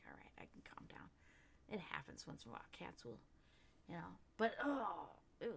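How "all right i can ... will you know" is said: whisper. Retold or imitated. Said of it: "I was like".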